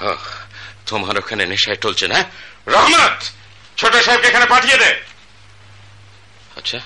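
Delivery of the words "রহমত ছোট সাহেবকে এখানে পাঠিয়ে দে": loud high call to the servant across the house. Acted. Father calls servant Rahmat to fetch his son, shouting. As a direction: shout the servant's name from afar.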